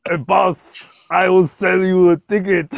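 high, loud mock Austrian accent; Arnold Schwarzenegger. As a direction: ham up an Austrian action-star accent. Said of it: "do an Arnold Schwarzenegger accent".

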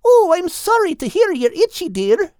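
high, sympathetic little bird; high bird voice, concerned; Bethu's voice. Acted. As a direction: coo it high and kindly.

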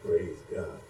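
normal talking voice

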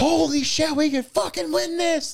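very high, loud screech of a thrilled fan; yelling. Retold or imitated. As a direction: scream it in elated disbelief. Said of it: "I'm yelling in my car".

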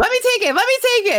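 very high-pitched imitation of someone begging to go first; high, insistent mimicry; mock eager pleading. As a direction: squeal it as an eager mock plea. Acting out Taylor's pushy plea.

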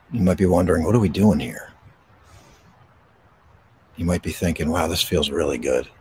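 gentle breathing-exercise guidance; guided meditation. Acted. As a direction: ease them into stillness, unhurried.

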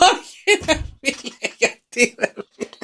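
hammed-up weepy voice, mostly high; crying skinny guy. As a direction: play the sad little guy crying into his drink. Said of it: "και βάζει τα κλάματα".

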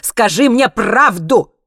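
slow, loud, high shout; shouted demand. A narrator's reading. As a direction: demand it, stretching every word. Interrogator shouts for the truth. (громко и протяжно требуя)

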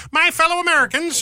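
super high-pitched, loud presidential address; Theodore Roosevelt. Acted. Host mimicking old recordings of the president, super high-pitched. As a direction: squeak it out as a loud speechmaker. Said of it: "He had a super, uh, high-pitched voice".

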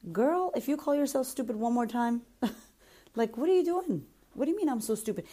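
high-pitched, exasperated telling-off; mock scolding. Acted scolding of a self-critical client.

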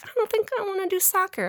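put-on high, quick kid voice; lightly voiced reluctant kid; daughter voice. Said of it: "She's like".

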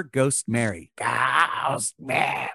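slow eerie ghost call; drawn out ghostly moan; spooky ghost voice. Acted. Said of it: "Why do I sound like an old hag?".

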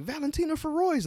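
high mock announcer saying Valentina Feroz; mimicking them. Retelling how the announcers said Valentina Feroz, high and mocking. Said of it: "And they were like".

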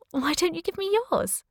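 high, flirtatious woman's voice; flirty. A narrator's reading. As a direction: coyly turn the request back on him. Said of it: "flirty, biting her lip".

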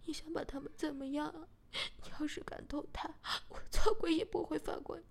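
fearful question breaking into a loud threat; scared then threatening. Acted. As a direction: start scared, end shouting the vow. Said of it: "小贤有点害怕地说". (先害怕后大声威胁)

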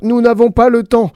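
cutting in. Acted. Exclamation Mark cuts off Comma, high and impatient. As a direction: cut them off impatiently. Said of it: "coupa point d'exclamation".

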